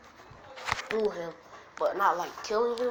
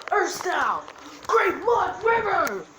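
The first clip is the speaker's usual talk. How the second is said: high, loud anime attack shout; battle cry; 11 semitones higher, 9 dB louder than usual. Acted. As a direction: yell the jutsu name, last words drawn out. Acted anime attack call.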